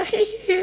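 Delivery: tiny high coy giggle; imitating her soft girlish titter.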